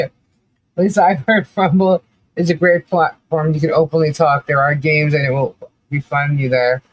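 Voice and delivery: ordinary delivery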